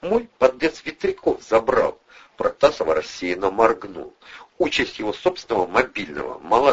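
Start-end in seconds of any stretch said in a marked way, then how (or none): 0.0-2.0 higher-voiced, mildly sheepish request; a little abashed, pitched up; slightly embarrassed. A narrator's reading. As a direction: ask with a touch of embarrassment. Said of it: "немного смущённо попросила Протасова".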